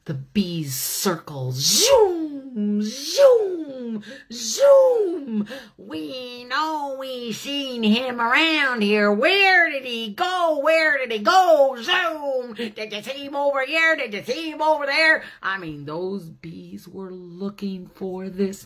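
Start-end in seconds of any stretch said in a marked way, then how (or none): 1.5-5.5 higher, louder bee zooms building up; buzzing zoom. A narrator's reading. To children, bees circling, rising buzzy zooms. 5.8-15.2 higher, louder buzzy bee voices, quick questions at the end; be the busy bees hunting. Acted. Bees search for the hidden bear, high and louder.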